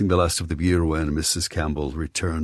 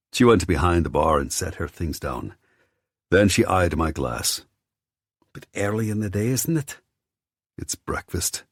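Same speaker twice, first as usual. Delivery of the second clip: Scottish landlady; 2 semitones higher than usual. A narrator's reading. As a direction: play the nosy, kindly pub landlady. Voicing landlady Missus Campbell.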